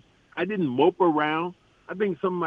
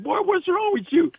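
much higher, amused disbelief; incredulous retort. Retold or imitated. Player answering a heckling teammate, high incredulous. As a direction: toss it back like he's lost his mind.

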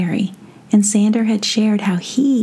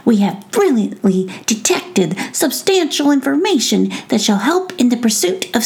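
lightly raised, grand royal voice; a proud little prince; fairy prince voice; 7 semitones higher than usual. Acted.

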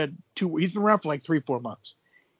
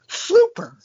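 high, sarcastic "Super."; mock enthusiasm. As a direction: say "Super." with fake excitement. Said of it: "I would look at him and go".

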